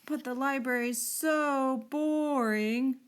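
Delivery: slow, drawn-out bored groan. A narrator's reading. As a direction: Belinda groaning about going to the library. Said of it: "groaned Belinda".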